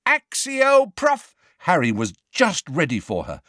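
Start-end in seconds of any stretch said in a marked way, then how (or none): 0.1-1.3 high, shrill cut-off shriek. A narrator's reading. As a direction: shriek the spell.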